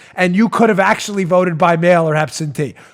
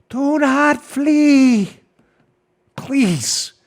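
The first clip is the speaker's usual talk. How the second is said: drawn-out, higher-pitched mock plea; 4 semitones higher, much slower than usual. Host mockingly begging revolutionaries on the battlefield, drawn-out mock plea. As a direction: stretch it out as a facetious appeal. Mock appeal to fleeing foes.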